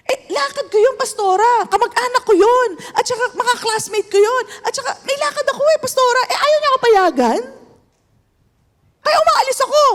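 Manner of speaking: high whiny voice of a defiant church member; defiant wife. Retold or imitated. Pastor mimicking a wife who refuses her husband, high whiny voice. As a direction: pitch it up and whine the excuse.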